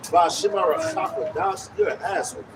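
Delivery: ordinary talk, nothing marked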